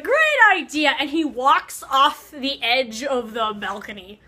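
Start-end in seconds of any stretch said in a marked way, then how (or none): 0.0-0.9 high, loud eager burst; overexcited shout of agreement; eager exclaim. Retold or imitated. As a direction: jump at the idea. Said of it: "Polidori's like".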